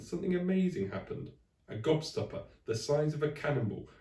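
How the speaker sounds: ordinary delivery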